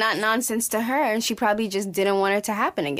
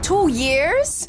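higher, louder shocked echo; stunned question; incredulous; 8 semitones higher, 4 dB louder than usual. Real, not acted. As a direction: repeat it in rising disbelief. Stunned echo of his answer.

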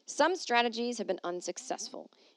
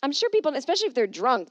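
excited; 5 semitones higher, 7 dB louder, faster than usual. Real, not acted. Excited loud comment.

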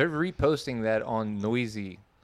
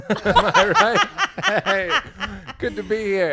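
high, laughing showman voice; 14 semitones higher, 6 dB louder, slower than usual. Acted. Hamming it up while laughing after saying something awful. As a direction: cover the gaffe with a laughing, hammed-up act. Hammy joke bit after a gaffe.

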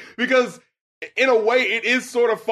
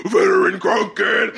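slow, louder mock backup-vocal yell; mock backup shout; 4 dB louder than usual. Reviewer mimicking the backup vocal, mock shout. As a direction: bark it like a hype man. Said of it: "the backup vocal goes".

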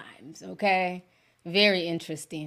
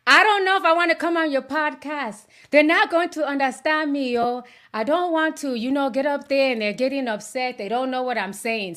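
mom's voice, higher and worried; nervous mom; 4 semitones higher than usual. Retold or imitated. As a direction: voice your anxious mother refusing. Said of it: "my mom was like".

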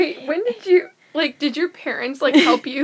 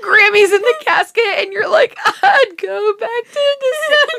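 loud, high, tearful laughter; real laugh breaking through the words; laughing to tears; 8 semitones higher, 5 dB louder than usual. Real, not acted. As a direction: deliver the joke while cracking up. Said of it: "I'm crying".

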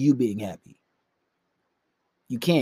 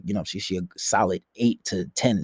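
ordinary delivery

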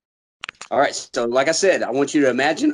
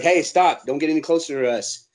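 ordinary talk, nothing marked

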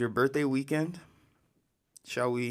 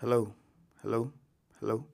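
echoey audio, like a cave; echoey mic; 2 semitones lower, 7 dB quieter than usual. Real, not acted. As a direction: talk through an echoing mic. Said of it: "my shit is sounding, like, echoey".

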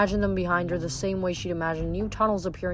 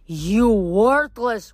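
loud, slow furious roar; enraged giant; 3 semitones higher, 8 dB louder, much slower than usual. A narrator's reading. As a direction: spit out the insult slowly. Wounded giant rages.

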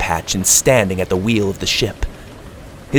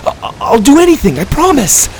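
panicked pleading; 6 dB louder, faster than usual. A narrator's reading.

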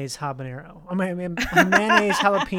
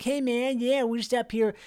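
lightly voiced laid-back local, pitched higher; a hint of a rambling hot-spring character, higher voice; Filthy Pete; 5 semitones higher than usual. Friend imitating a man met camping by Mono Lake, light impression. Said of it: "he kinda talked like this".

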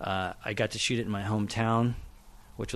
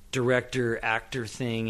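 ordinary talk, nothing marked

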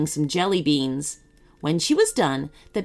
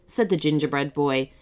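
plain reading voice

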